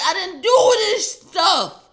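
high, loud, slow whiny denial; whiny protest. Retold or imitated. Son wailing his innocence to mom. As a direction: wail it like a kid caught out.

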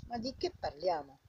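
ordinary talk, nothing marked

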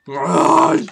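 sudden loud cry of frustration; real exasperated yelp; startled yell. Real, not acted. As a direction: blurt it out loud. Rage at stuck car.

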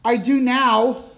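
loud, high, drawn-out outburst; slow, emphatic shouted confession. A narrator's reading. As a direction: blurt it out at full volume. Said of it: "shouted Paps".

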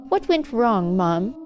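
put-on high, plaintive child; wailing complaint. A narrator's reading. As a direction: mildly wail at the unfairness. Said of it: "his wailing complaints".

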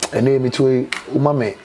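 ordinary delivery

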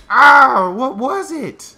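high, loud shriek then slow shaken question; shriek, then ask shakily; scared shriek. Real, not acted. Gamer jumped by something unseen, scared shriek.

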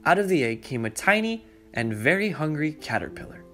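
plain reading voice

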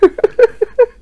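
short loud rising giggle; real giggle; high squealing laugh. Real, not acted. After repeating the punchline.

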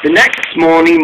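plain reading voice

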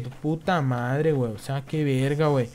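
normal talking voice

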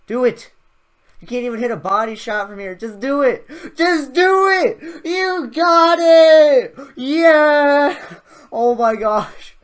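high, loud pleading shout; excited screaming. Real, not acted. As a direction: scream at him to do it. Begging the default to shoot.

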